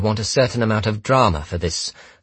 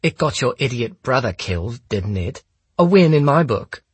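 higher-pitched, smug taunt; smirking; 7 semitones higher than usual. A narrator's reading. Holmes taunts the gunman about his brother, smirking. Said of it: "I could hear the smirk in Holmes's voice".

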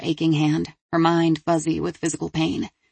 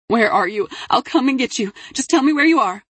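urgent, higher pleading; frantic mother; 6 semitones higher, 4 dB louder than usual. A narrator's reading. Delia begs her daughter to tell where she is, frantic. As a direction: plead and promise urgently, pitch climbing.

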